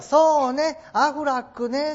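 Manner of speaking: high, loud mock-motherly agreement; mother to child. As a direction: play a mum heartily agreeing with her little girl. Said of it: "もちろんお母さん".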